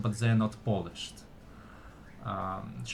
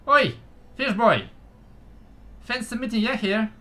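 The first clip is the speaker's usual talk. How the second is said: high, loud little gnome voice; squeaky gnome calling out; tiny voice; 12 semitones higher, 6 dB louder than usual. Acted. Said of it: "you hear a tiny voice".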